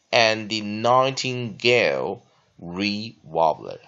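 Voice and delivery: slow, deliberate reading with pauses between phrases; teacher's careful slow model reading; slow read-along. Shown as a demonstration. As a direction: read it slowly so students can repeat. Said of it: "那跟老师再来慢慢念一遍".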